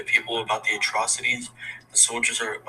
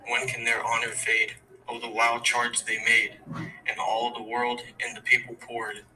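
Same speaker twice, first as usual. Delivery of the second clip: poem recitation. Acted. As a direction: recite it like a memorial verse, steady and grave. Student recites own war poem.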